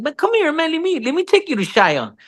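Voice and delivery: high, animated retold call; eager beckoning. Dad offers to take his son to Shia, eager beckoning. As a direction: wave the kid over, voice lifted.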